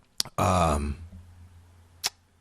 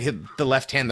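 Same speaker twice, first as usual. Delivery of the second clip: ordinary delivery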